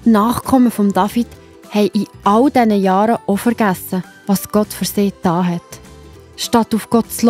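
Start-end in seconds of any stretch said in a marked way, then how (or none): none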